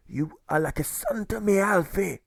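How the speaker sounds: high, gentle voice of the good German; fond fatherly German. A narrator's reading. The good German telling Alfred he is like a son. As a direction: speak to him like a beloved son. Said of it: "he says with reverie, glee, and cheer".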